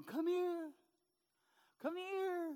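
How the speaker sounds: high, coaxing parent voice; coaxing toddler. Pretend. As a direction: call a baby to walk to you.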